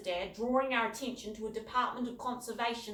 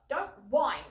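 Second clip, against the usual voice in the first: stern father; 5 semitones higher, 8 dB louder than usual. A narrator's reading. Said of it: "said Dad".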